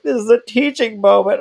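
high, loud weepy announcement; hammed-up tearful voice; mock crying. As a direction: imitate teaching through tears. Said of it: "I was just sitting there crying".

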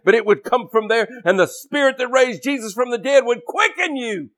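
high-pitched, emphatic pulpit delivery; fervent preaching. Real, not acted. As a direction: preach it with rising fervor. Preaches at full force.

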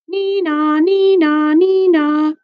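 high, loud two-note nee-naw; sing-song "nee-naw" siren, held high; siren imitation. A narrator's reading. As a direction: wail like an ambulance siren. Narrator imitates the ambulance siren.

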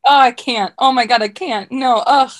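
hammed-up exasperation, dragging the last phrase; Danae. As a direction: imitate a friend who just can't take it. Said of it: "You're like".

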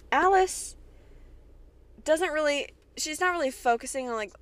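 high exasperated name call. Real, not acted. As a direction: say her name in disbelief. Exasperated at the incest plot.

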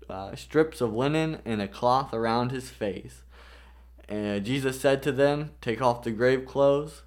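loud, earnest Bible reading; scripture reading. Acted. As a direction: read the passage out with weight. Reads John eleven aloud.

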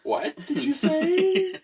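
high, slow, stretched-out meme catchphrase; mock incredulous. Friend pouncing on a mumbled joke, high mock incredulity. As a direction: pitch it way up and drag it out.

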